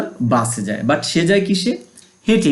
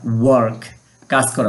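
usual speaking voice

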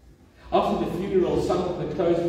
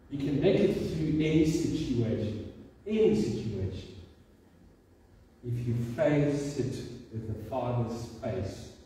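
normal talking voice

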